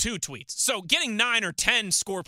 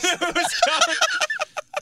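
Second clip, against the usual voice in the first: shrill laugh much higher and louder; high squealing laugh; 5 dB louder than usual. Real, not acted. Laugh after Kenny Blunt.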